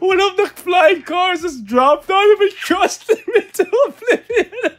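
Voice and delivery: high, loud laughing through words; can barely talk for laughing; laughing hard. Real, not acted.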